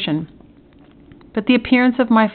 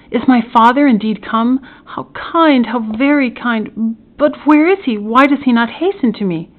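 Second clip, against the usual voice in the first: higher-pitched, eager wondering questions; eager joy; 5 semitones higher, 4 dB louder than usual. Acted. Prisoner hears his father has come, eager and overjoyed. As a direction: gush with grateful impatience.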